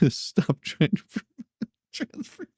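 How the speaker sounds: usual speaking voice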